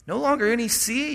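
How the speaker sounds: rising pitch of disbelief on longer; incredulous question. Shown as a demonstration. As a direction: repeat it as if you can't believe it. Repeats the verse in disbelief.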